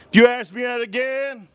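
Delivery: a half-real shout of warning; mock angry shout. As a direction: snap back as if at the end of your rope. Kid snaps back at teacher.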